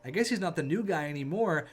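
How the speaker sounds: ordinary delivery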